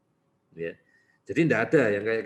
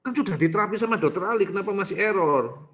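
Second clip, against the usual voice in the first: higher, louder whiny parent complaint; mock complaining parent; 9 semitones higher, 5 dB louder than usual. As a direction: play the parent blaming the doctor. Doctor mimics complaining parent. (meniru orang tua yang mengeluh, nada tinggi)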